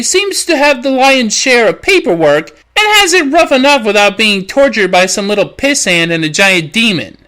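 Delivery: usual speaking voice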